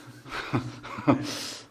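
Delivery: soft unvoiced chuckle; a quiet airy laugh to yourself; light breathy laugh. Real, not acted. Laughs at own story.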